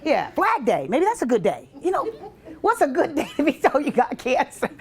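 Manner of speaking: ordinary talk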